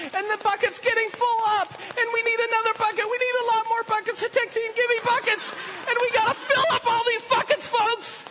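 high, loud rallying cry; rousing shout. Real, not acted. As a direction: whip the crowd up at full force. Preacher rallying crowd at full force.

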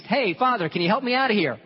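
loud, high shout for attention; calling out. Acted. Trapped man calls up to the passing priest, calling out. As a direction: holler up to someone far above.

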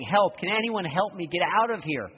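high, loud cry for help; desperate call to passersby; pleading yell. Retold or imitated. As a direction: shout up from the hole, begging. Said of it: "Keeps yelling, pleading".

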